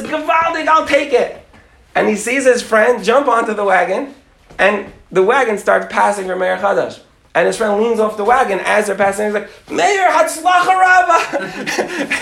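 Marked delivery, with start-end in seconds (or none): 0.0-1.4 high-pitched delighted acceptance; acted holler, jumping at the offer; eager shout. Friend shouts acceptance to wagon driver. 9.7-12.2 long, drawn-out high holler; comic yell fading off on a departing wagon; shouted farewell. Acted. Friend yells farewell from moving wagon.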